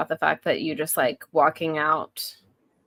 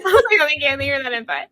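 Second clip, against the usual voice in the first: high, mock-grateful thank you; sarcastic thanks; 6 semitones higher, faster than usual. Wife mocking her husband's texted input, sarcastic. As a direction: thank him with a wink. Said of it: "I was like".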